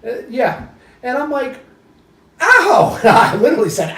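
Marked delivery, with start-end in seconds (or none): 2.4-3.0 high, loud "ow"; retold yelp of pain. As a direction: yelp as if banging a knee. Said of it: "out loud".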